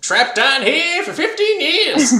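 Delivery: put-on high, slow crackly old-timer voice; old prospector. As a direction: croak it out like a lost old miner. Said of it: "Old prospector".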